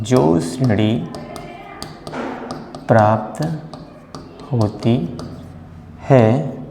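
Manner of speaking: word by word with long pauses; slow dictation. Shown as a demonstration. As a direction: dictate so students can write. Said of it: "आप भी अपना note करते जाइए".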